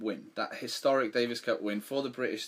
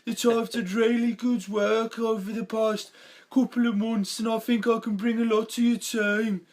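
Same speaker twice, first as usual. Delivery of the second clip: hammed-up Birmingham accent, pitched way up; mock Birmingham accent; 11 semitones higher, 7 dB louder than usual. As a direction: play a Brummie job applicant in his interview. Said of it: "a Scouse accent or, or a Birmingham accent".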